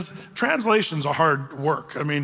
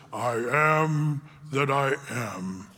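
very slow, low, solemn divine voice; deep, unhurried, awe-filled declaration; voice of God; 4 semitones lower, much slower than usual. Acted. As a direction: intone it like God from the burning bush. The bush, God, speaks to Moses.

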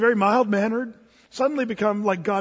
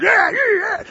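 mock tough guy; 11 semitones higher, 7 dB louder than usual.

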